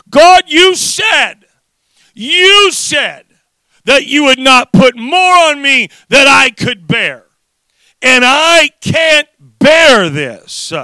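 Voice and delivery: loud, high, drawn-out desperate cry; screaming out to God. Retold or imitated. Father re-enacting his midnight plea to God, screaming out. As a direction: cry out in reenacted anguish, stretching the words. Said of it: "screaming out to God".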